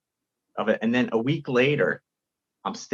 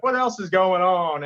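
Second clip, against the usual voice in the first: a light take on Seinfeld's slow, higher drawl; Seinfeld impression; 7 semitones higher than usual. Comic imitating Seinfeld's famous crowd line, lightly. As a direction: lightly mimic Jerry's sing-song opener. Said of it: "it's, like, his voice".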